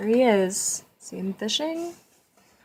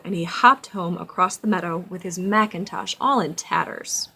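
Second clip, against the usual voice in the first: storybook narration; 10 dB louder than usual. A narrator's reading. Reads storybook aloud to child.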